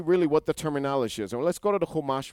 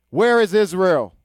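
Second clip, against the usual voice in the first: preaching question; 5 semitones higher, 10 dB louder than usual. Real, not acted. Preacher asks the congregation.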